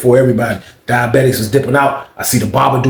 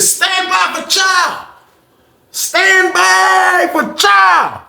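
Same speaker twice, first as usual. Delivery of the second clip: slow, high, drawn-out announcement call; intercom call; much slower than usual. Retold or imitated. Lady guard announcing chow over the intercom, slow drawn-out call.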